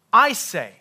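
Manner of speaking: "I" hit high and very loud, "say" falling; emphatic, loud I; stress on I. Shown as a demonstration. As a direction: punch the "I" in "I say". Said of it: "just emphasize a different word".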